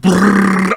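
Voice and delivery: loud brrr of frantic typing; typing noise. Acted. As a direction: rattle out a keyboard noise. Tippgeräusch nachgemacht.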